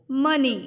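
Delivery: "मनी" loud, as MUH-nee; teacher modelling "मनी" for the class; short uh sound. Shown as a demonstration. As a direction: stress the uh in "मनी". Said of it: "अ की sound आ रही है".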